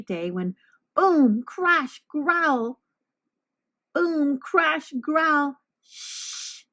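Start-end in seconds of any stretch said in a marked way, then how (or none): 0.9-5.6 monster noises. A narrator's reading. 5.8-6.6 a sharp hushing whisper; unvoiced shh; whispered shush. Librarian hushing the noise.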